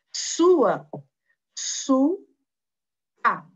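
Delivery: Sua then su- and a, as separate beats; split into syllables. Shown as a demonstration. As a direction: break Sua apart, su, pause, a. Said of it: "Tem duas sílabas".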